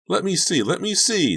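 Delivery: put-on very high, loud excited child yell; yelling little boy. A narrator's reading. Donnie wants to see the mask, high excited yell. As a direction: clamour like an eager kid. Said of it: "Donnie yelled".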